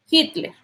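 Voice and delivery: Hitler begun with a Spanish J sound; "Hitler" with the H aspirated, never silent. Shown as a demonstration. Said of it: "La H cuando suena como J".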